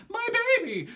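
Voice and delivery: high, loud mocking falsetto. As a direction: jeer like a taunting kid in falsetto. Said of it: "in falsetto".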